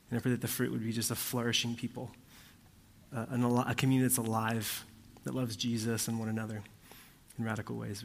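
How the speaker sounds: soft, low prayer voice; praying quietly and reverently. Acted. Closing prayer before sermon.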